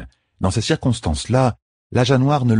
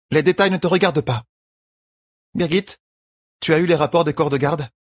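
higher, dismissive then businesslike; lightly voiced queen, pitched up; brisk young queen; 10 semitones higher than usual. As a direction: brush him off and demand the report. Voices Elaine questioning Birgit.

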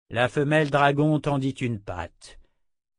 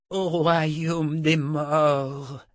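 very slow, high-pitched croak; 6 semitones higher, much slower than usual. A narrator's reading. Trapped wizard speaking from his trance, croaking. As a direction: drag it out as a croak. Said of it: "croassa le sorcier".

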